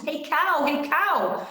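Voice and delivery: high-pitched urgent call; storyteller's mild cry to a wounded animal. A narrator's reading. Girl calls to wounded cow.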